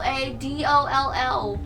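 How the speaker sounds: slowly spelled-out "A D O L L", letter by letter; emphatic spelling. Shown as a demonstration. Spelling the scary find for listeners, "D O L L" slow and emphatic. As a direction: stretch each letter of "D O L L".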